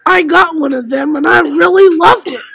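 loud, hammed-up advert voice; loud mock pitch. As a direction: sell it loudly like a kid's commercial. Loud mock ad about punishment.

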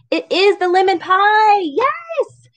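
high, squealed delight; gleeful and rising; excited cheer. Real, not acted. Reader cheers at picture.

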